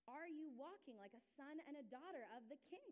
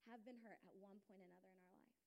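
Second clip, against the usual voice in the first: usual speaking voice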